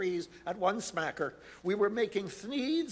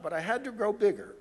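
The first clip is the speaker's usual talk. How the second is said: ordinary delivery, nothing marked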